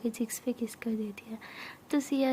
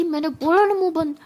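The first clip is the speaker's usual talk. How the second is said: loud, higher-pitched repeated order; angry scolding; 4 semitones higher, 6 dB louder than usual. Acted. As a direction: silence her with an angry reprimand. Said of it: "तुम मुझे डांट क्यों रही हो". (डांटकर चुप कराना)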